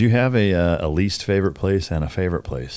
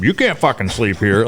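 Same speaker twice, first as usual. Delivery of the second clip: louder, higher mock cop order; stern cop; 6 semitones higher, 6 dB louder than usual. Cop in Walmart lot, loud mock order. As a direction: bark it like a cop.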